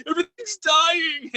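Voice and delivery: high, giggly alarm as the cameras die; giggling panic. Retold or imitated. Investigator as the cameras die, giggling nervously. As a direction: laugh nervously through it. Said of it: "I'm, like, even giggling".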